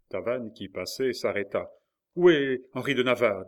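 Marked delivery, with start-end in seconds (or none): 2.1-3.5 loud, high pressing question; urgent demand. A narrator's reading. Marguerite presses Tavannes about Henri, loud and urgent. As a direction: insist on an answer. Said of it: "dit Marguerite".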